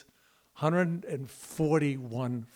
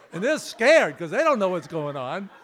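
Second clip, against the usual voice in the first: usual speaking voice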